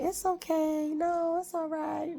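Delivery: mock her own pushover self, lilting up high; overly nice self. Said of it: "I'm the type of person to just be like".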